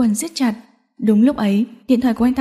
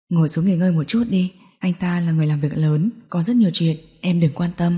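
low male voice, softened; Alex, gentle and soothing; 5 semitones lower, 3 dB quieter than usual. A narrator's reading. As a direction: reassure her in a low, calm voice. (giọng nam trầm, dịu dàng trấn an)

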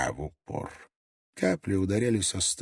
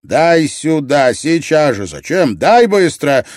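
loud, high, urgent orders; angry shouted demands; 6 semitones higher, 10 dB louder than usual. A narrator's reading. Ott demands the newborn from Gref, angry shout. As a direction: shout impatient commands.

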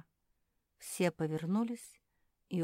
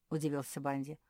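usual speaking voice

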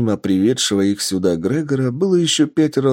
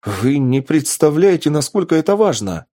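higher, urgent insistence; взволнованно настаивая; 6 semitones higher than usual. Acted. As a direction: press the professor, excited and earnest. Лаборант настаивает на срочности. (взволнованно, настойчиво)

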